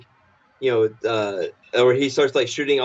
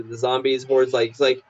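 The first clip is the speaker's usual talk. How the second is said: ordinary talk, nothing marked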